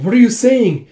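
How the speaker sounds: high, loud incredulous question; alarmed disbelief. Acted. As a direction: question in shocked protest. Alarmed reply to trapped Zeno.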